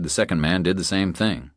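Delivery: ordinary delivery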